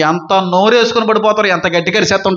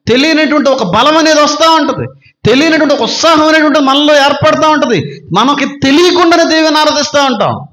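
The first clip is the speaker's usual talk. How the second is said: raised, driving sermon voice; fervent preaching; 4 semitones higher than usual. Acted. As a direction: preach it up with fire. Preaching at full force. (ఉత్సాహంగా ప్రసంగం)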